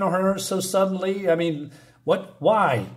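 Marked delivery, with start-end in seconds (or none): none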